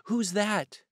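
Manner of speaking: high, small, frightened call; frightened little voice. A narrator's reading. Startled washerwoman calling to the knocker, frightened. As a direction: call out timidly through the door. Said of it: "A little frightened voice called out".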